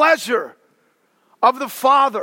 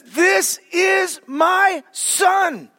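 shouted, much higher, very slow; booming voice of God; 7 semitones higher, much slower than usual. Retold or imitated. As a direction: proclaim it from the mountaintop. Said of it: "then the Father says".

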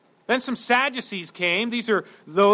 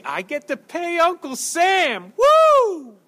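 high-pitched sarcastic cheer, ending in a loud woo; mock excitement; 8 semitones higher, 4 dB louder, slower than usual. Preacher mocking anyone who loves paying taxes, gleeful fake cheer. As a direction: whoop it up with fake glee.

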